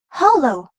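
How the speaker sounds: "hollow" stressed on HOL; strong first syllable in "hollow", weak ending. Shown as a demonstration. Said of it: "The second syllable contains O".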